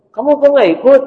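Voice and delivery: put-on high, loud lady's voice asking the boy; rich mother asking. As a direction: lean out of the car and ask.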